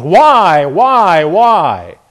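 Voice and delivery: loud mock wail, falling away; drawn-out mock scream, each cry sinking; imitated scream. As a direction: imitate a nursing-home patient crying out. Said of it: "scream as they do in".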